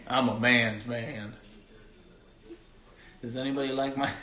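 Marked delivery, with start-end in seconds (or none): 0.1-1.3 put-on slow drawled southern accent; lightly voiced southern accent. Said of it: "my southern accent?".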